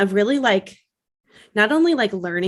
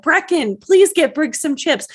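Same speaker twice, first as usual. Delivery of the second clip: high, louder, slowing mom imitation; mock pleading call; 9 semitones higher, 5 dB louder than usual. Imitating mom sending a child for chips mid-sale, pleading call.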